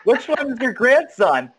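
mock outraged exclamation. Actor imagining his grandmother watching his show, mock outrage. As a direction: pitch climbing into an exaggerated cry.

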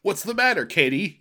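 high mock cry. Player calls to Katie in a high put-on voice. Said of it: "you cry".

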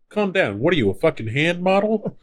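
high, incredulous quoted outburst; high-pitched mock exasperation; hammed-up losing it. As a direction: act out the buddy's exasperated jab. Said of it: "starting to lose his shit".

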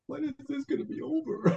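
high, quiet, restless whine; impatient. Retold or imitated. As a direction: fidget and sigh it out. Impatient mock inner voice while meditating.

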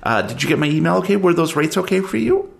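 high, eager clerk voice, a bit fast; chirpy office clerk. Acted. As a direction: play the fussy storage clerk, all smiles. GM plays the clerk.

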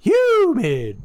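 high falling holler; echoed show-name call. Pretend. Cohost echoing the show's joke name, drawn-out holler. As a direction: draw it out high, then swoop down.

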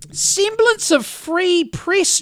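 slow, high mocking repeat; mocking scorn. Real, not acted. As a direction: sneer each word slowly. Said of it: "this bit really sets me off".

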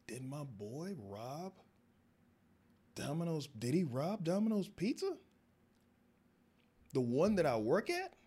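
disbelief growing into outrage; rising disbelief. Retold or imitated. Young man realizing his friend robbed his job, building outrage.

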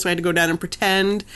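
ordinary delivery

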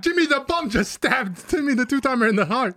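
high, rushed alarm; panicked announcer. Acted. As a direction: hurry to clear the kids out. Announcers panic at a real stabbing.

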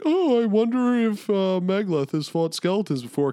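very high, sing-song feigned wondering; mock subtle hinting. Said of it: "like, subtle".

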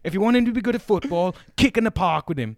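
high, loud and fast imitated scolding; hammed-up teacher scolding; mock angry rant. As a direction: lecture the mum in a put-on voice. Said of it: "And he's like".

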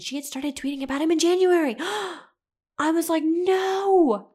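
loud, high, shocked cry of disbelief; shocked outburst. Retold or imitated. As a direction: relive the shock at full volume. Discovering ex's new girlfriend.

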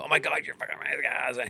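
fast, high, over-the-top gushing; gushing praise. Retold or imitated. Comedian mocking easy fake enthusiasm, gushing fast. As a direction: hype them up like a fan. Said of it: "It's easy to be amazing".

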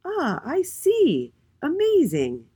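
high, drawn-out wonder; amazed storybook exclamation; delighted realisation. A narrator's reading. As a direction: stretch it out high and loud in amazement. Miss A delightedly understands.

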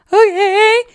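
high, loud meek okay; tearful little okay. Retold or imitated. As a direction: answer like a weepy obedient child. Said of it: "I'm like".

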